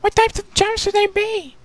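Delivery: very high, small voice; timid princess. Acted. Pupa asking her sisters, high and timid. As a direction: ask naively.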